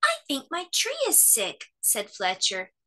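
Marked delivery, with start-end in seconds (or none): 0.0-1.7 lightly raised little-bear voice; a small worried cub. A narrator's reading. Bear cub tells Mummy his tree is sick, worried.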